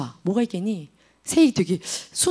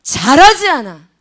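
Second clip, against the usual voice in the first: normal talking voice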